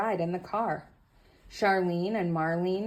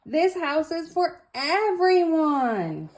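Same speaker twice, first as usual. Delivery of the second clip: higher, louder, slow chant; shouted together; 11 semitones higher, 6 dB louder, slower than usual. Acted. All the children answering George together, loud and slow. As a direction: sing it out as a joyful group. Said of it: "shouted Lindy".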